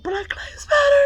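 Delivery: high tearful shout of the tic; crying shout. Retold or imitated. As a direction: cry it out high through tears. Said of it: "that lady who fucking shouts out".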